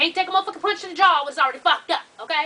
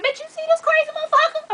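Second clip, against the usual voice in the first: much higher-pitched mock disbelief; incredulous reaction; 13 semitones higher than usual. She silently scolds the laughing pregnant woman, high-pitched disbelief.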